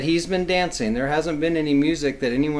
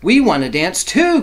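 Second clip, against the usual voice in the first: excited sheep; 4 semitones higher, 8 dB louder than usual. Acted. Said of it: "cried the sheep".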